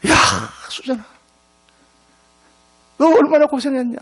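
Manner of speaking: emotional mother's cry. Retold or imitated. Mother embraces daughter, crying out.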